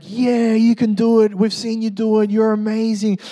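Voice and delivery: high, loud excited crowd cheer. Retold or imitated.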